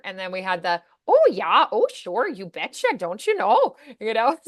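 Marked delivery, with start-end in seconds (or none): 1.1-3.8 put-on bouncy, up-and-down Minnesota accent. Daughter imitating her mom's side of the family, Minnesota accent. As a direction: swing the pitch in a Minnesota lilt. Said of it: "a mom from Minnesota".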